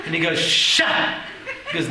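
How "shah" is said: a voiceless, loud "Shah"; shushing. Retold or imitated. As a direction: hush him sharply with a breathy "Shah". Brisker seems to shush him.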